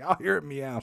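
a hint of indignant old dad; dad insisting he'd hear it, lightly voiced; protesting dad. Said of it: "And he's like".

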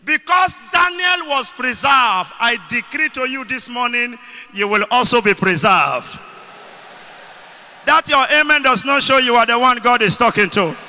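loud, high shouted declaration; rousing preacher's shout, pressing for an amen; full-force preaching. Real, not acted. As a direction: decree it over the congregation at full voice. Declares blessing over congregation.

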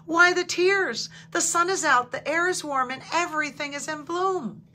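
a little bird chirping cheer at a sad friend; sparrow's high chirpy call, settling down by the end. A narrator's reading. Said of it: "Sparrow chirped".

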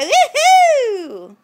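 high, loud, laughing cheer; gleeful whoop; excited woohoo. Real, not acted. Cheering baby's bouncing.

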